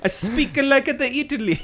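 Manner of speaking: put-on high, hammy Italian accent; a mock Italian accent, laughing through it. Showing off his Italian accent to the chat, mock Italian accent. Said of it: "the Italian accent works".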